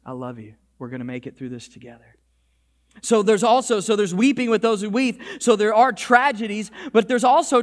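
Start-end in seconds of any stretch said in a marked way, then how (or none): 0.1-2.2 very soft, low comforting voice; low, hushed and tender; loving reassurance. Retold or imitated. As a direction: reassure them gently, barely above a murmur. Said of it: "Supernatural living says".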